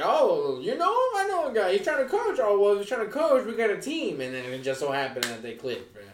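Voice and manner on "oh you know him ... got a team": high mock voice. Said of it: "they were just like".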